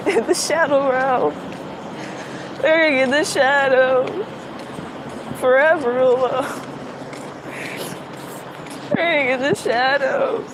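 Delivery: lightly voiced meme quote; quoting a TikTok bit. Vlogger quoting the shadow realm TikTok, lightly voiced. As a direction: drop it like a spooky meme line. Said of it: "if y'all know Straw Hat Dan from TikTok".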